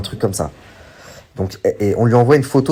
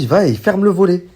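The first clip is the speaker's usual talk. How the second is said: incredulous retelling; 11 semitones higher, 4 dB louder than usual. Retold or imitated. Wife's outraged account of husband.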